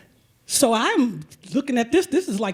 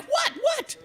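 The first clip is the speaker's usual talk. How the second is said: much higher, squeaky alarmed "what, what"; startled. Retold or imitated. Teller startled by the pedicurist, squeaky alarm. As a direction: jump in panic at the order.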